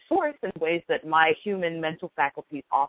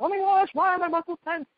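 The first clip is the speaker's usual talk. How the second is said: mock panic; 13 semitones higher than usual. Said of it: "rather than panicking and freaking out".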